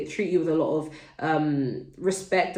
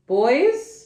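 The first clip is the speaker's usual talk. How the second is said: high, loud call to the next room; 7 semitones higher, 6 dB louder than usual. Real, not acted. Calls out to her kids mid-video.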